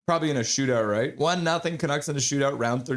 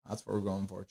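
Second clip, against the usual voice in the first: ordinary delivery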